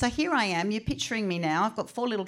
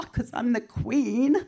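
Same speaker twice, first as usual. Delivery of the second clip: mock regal; 6 semitones higher, 4 dB louder, slower than usual. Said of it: "And I'm like".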